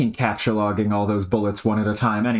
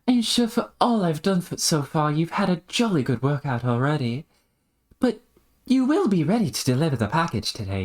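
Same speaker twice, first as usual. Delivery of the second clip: polite, arch character voice, often pitched up; put-on proper voice, lilting; genteel schemer; 5 semitones higher than usual. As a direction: speak with mannered courtesy as you press a favor. Plays a character in dialogue.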